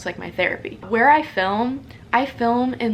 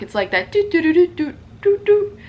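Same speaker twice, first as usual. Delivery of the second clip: voices the knock as a little tune, higher and louder; sung knock rhythm; 6 semitones higher, 4 dB louder than usual. Retold or imitated. Telling viewers how the neighbor knocks, sung knock rhythm. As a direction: tap out the neighbor's knock with your voice. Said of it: "a distinct knock".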